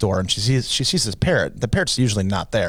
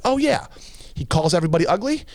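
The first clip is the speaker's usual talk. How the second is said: high-pitched questioning retort; woman's retort; 7 semitones higher than usual. Acted. Woman questioning the store owner, high-pitched retort. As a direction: answer as the woman, starting way up high. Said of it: "She's like".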